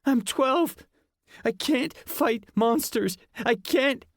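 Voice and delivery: put-on high squeaky frightened boy; a scared boy begging off; pleading kid. A narrator's reading. Ian pleading.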